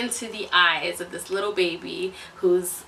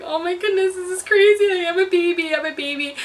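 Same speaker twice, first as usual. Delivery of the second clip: high, mock-tearful disbelief; retold overwhelmed; 8 semitones higher than usual. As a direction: replay being overwhelmed, pitched way up. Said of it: "I cried the whole way home".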